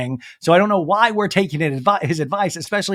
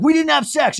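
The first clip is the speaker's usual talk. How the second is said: mock yell; 11 semitones higher, 4 dB louder than usual. Austin yelling, everybody screaming.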